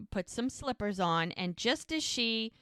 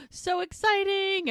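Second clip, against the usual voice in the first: high, gushing excitement after a gasp; thrilled; 10 semitones higher, 4 dB louder than usual. Real, not acted. Host recapping the dress reveal, real thrilled squeal. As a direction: burst out with delight.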